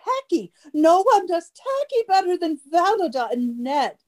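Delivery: fast, high, catty society gush; gushing gossip. Acted. Party guest gushing to Greta about the hosts, catty and fast. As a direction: dish the dirt breathlessly.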